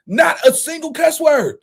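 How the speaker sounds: ordinary delivery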